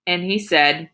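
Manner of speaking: ordinary delivery, nothing marked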